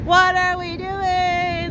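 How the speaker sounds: loud, drawn-out disbelief; slow, loud wail of disbelief; dismayed at cold water. Real, not acted. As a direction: stretch out the words in loud dismay. About to wade into freezing water.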